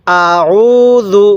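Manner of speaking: আউযু read very slowly with a soft জ; soft zal. Shown as a demonstration. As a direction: soften the জ in আউযু and stretch it. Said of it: "জালটা নরম করে".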